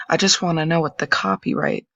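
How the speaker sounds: normal talking voice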